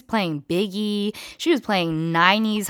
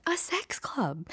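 breathy whispered secret; 4 semitones higher, 11 dB quieter, slower than usual. Real, not acted. Telling the viewers a scandalous secret in a whisper. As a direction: lean in and whisper it. Confiding a shocking detail.

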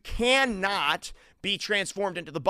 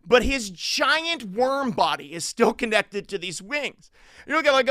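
ordinary delivery

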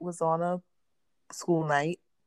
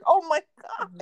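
higher, louder mock disbelief; exasperated oh my God; 12 semitones higher, 7 dB louder than usual. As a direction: say it like you can't believe him. Exasperated at husband.

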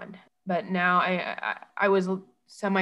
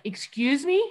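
retold indignant question, pitched up at the end; affronted disbelief; 6 semitones higher than usual. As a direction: echo your taken-aback retort. Affronted reply to husband's accusation.

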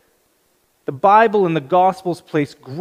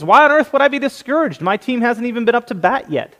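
put-on cheerful kid; 7 semitones higher than usual. Boy cheerful despite losing.

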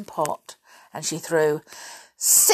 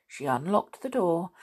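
ordinary delivery

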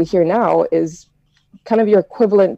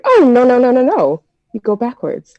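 high, rapid-fire string of no's, then settling; correcting grandmother; 6 semitones higher than usual. Acted. Grandmother corrects child eating soup, scolding. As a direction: play a grandma halting bad manners.

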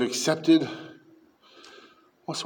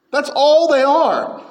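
louder, slower, "all" stretched and hammered; louder slow repeat; 10 semitones higher, 5 dB louder, slower than usual. Acted. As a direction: shout it home the second time. Preacher repeats for force.

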